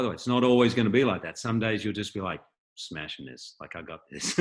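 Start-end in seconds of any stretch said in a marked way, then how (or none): 2.8-4.4 low, hushed cocky inner voice; mock self-assurance. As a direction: murmur it deep, like a smug private thought. Acting out an actor's self-confident thought.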